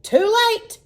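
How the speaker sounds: high, loud triumphant yell; gleeful yell. A narrator's reading. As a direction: crow it out in delight. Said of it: "yelled Billy gleefully".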